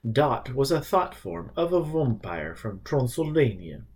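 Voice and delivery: a hint of Transylvanian accent; thick vampire accent. Donald explaining to Richard in a lightly voiced vampire accent. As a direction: keep the vampire accent going. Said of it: "in words thicker than the creature's own".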